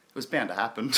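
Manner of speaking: ordinary talk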